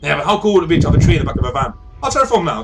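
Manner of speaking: fast, loud, high burst of enthusiasm; excited device. Acted. Device wanting a tree in the van, excited. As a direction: blurt it out, carried away with the idea.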